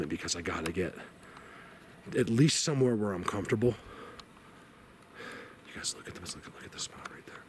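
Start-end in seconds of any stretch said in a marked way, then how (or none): 5.7-7.4 hushed whisper, no voice at all. Real, not acted. Pointing out a spot to viewers, whispering. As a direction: whisper it so the woods won't hear.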